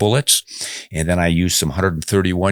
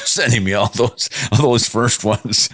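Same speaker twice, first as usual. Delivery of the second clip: ordinary talk, nothing marked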